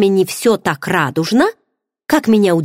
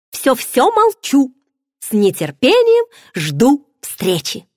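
slow, higher teasing surrender; playful retreat; 7 semitones higher, slower than usual. Acted. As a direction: back off with a wink, slowly. Friend playfully backs off. (шутливо сдаётся, медленно)

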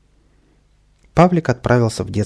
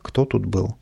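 plain reading voice

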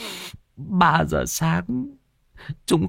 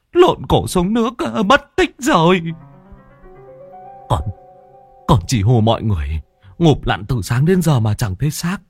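high-pitched tearful wail; choked sobbing; 8 semitones higher than usual. Acted. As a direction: wail out a desperate plea through acted sobs. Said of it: "khóc nghẹn ngào". (giọng khóc nghẹn ngào)

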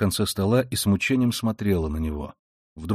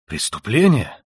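snapping louder and higher into rage; sudden fury; 4 semitones higher, 6 dB louder than usual. A narrator's reading. Brother flaring up at his sister's word crime, furious. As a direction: flare up from nowhere. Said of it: "вскричал он вдруг в каком-то внезапном бешенстве".

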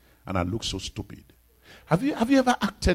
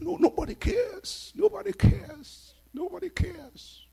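mock self-pity; slower than usual.

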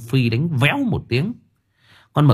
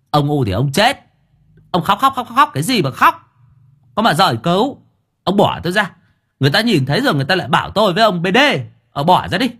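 much higher, louder exasperated telling-off; exasperated scolding; 9 semitones higher, 4 dB louder, faster than usual. A narrator's reading. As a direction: push him off, fed up. Master shaking off crying servant. (quát, giọng cao và to)

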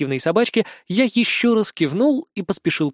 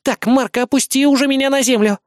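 put-on high, impatient girlish demand; annoyed demand; 9 semitones higher, 4 dB louder than usual. A narrator's reading. Carried idol girl to her captor, annoyed. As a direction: order him in girlish irritation.

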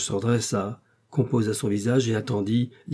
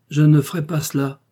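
firm refusal; 5 semitones higher, 7 dB louder than usual. Acted. Wife firmly refuses husband.

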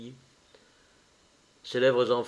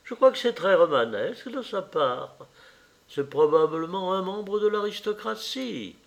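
a lightly voiced fussy mother, higher; the mother lecturing her son, a touch higher; mother's voice; 6 semitones higher than usual. The mother again. (la mère qui sermonne son fils, voix un peu plus aiguë)

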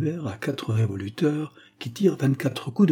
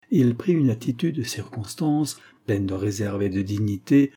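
ordinary delivery, nothing marked

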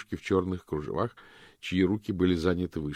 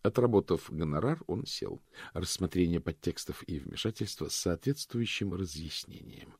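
plain reading voice

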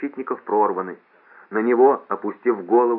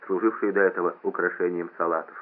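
plain reading voice

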